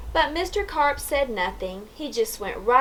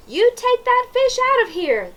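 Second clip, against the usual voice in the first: much higher, louder angry shout; yelled; 13 semitones higher, 4 dB louder than usual. A narrator's reading. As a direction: chase the fish out. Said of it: "they yelled".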